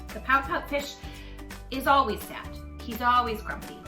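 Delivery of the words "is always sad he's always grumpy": ordinary delivery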